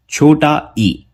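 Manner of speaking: normal talking voice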